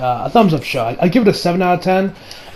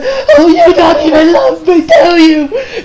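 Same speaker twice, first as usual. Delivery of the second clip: high, loud tearful wail; mock sob it out, half laughing; imitating crying wife; 11 semitones higher, 4 dB louder, slower than usual. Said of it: "she's still crying".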